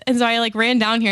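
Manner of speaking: usual speaking voice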